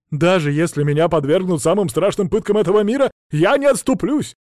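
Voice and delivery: loud, rising passionate oath; fervent vow. Acted. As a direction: swear it with burning devotion. Bard vowing devotion.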